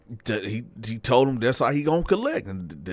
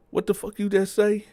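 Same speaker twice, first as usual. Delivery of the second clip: higher-pitched aggressive demand; retold aggressive challenge; 12 semitones higher than usual. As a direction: imitate him snapping back, confrontational. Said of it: "in a real aggressive tone".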